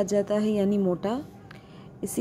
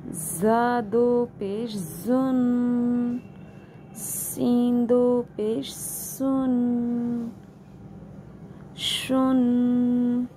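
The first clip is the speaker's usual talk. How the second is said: slow drawn-out spelling drill; recitation drill; 3 semitones higher, much slower than usual. Shown as a demonstration. Quran teacher drilling students through the letters slowly. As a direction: stretch each syllable with nasal ghunna.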